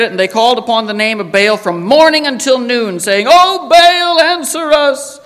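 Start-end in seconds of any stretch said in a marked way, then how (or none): 3.3-5.2 high, louder cry to the idol; pleading call. Retold or imitated. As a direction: call out to Baal, begging. Prophets calling on Baal.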